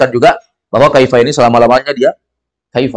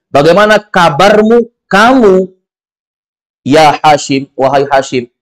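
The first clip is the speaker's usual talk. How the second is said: higher, slow emphatic question; 3 semitones higher, slower than usual. Shown as a demonstration. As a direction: press on kamu to make it clear. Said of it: "Supaya lebih ditekankan". (pertanyaan dengan tekanan pada kamu)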